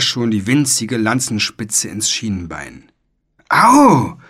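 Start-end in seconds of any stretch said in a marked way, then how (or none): 3.5-4.2 high, loud cry of pain; indignant yell. A narrator's reading. Boy pricked in the shin, an indignant high yell. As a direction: yelp as if jabbed. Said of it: "schrie Philipp empört".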